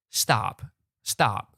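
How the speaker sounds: ordinary talk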